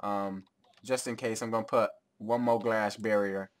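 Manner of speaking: ordinary delivery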